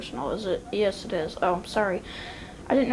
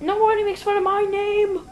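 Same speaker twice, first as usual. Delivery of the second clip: high-pitched, affronted protest; indignant outburst; 10 semitones higher than usual. Acted. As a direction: bristle at being made fun of, pitched well up. Angry retort over mocked name.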